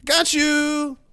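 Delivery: high, laughing mock of a general brushing it off; giggling sarcastic prank voice. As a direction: taunt them like a smug boss with a joke. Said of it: "and then just go".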